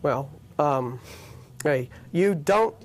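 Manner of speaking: usual speaking voice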